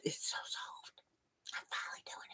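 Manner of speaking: breathy hushed delight over soft yarn; delighted whisper. Real, not acted. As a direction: whisper it like a treasured secret. Touching cherished yarn, delighted.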